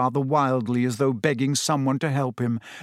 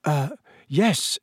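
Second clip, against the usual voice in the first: nervous; 2 semitones higher than usual. A narrator's reading. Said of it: "said Neville nervously".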